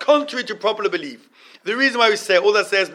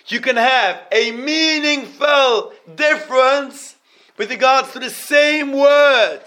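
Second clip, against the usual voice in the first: higher, louder, slow lecturing emphasis; raised, drawn-out explanation; emphatic teaching; 8 semitones higher, 7 dB louder, much slower than usual. Acted. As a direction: drive the point home to the class.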